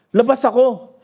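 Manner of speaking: ordinary delivery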